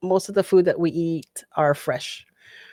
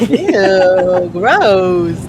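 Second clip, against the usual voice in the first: louder ew, gross; disgusted; 4 dB louder than usual. Real, not acted. Reacting to dissolved burger, loud disgust. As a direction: recoil at the sight.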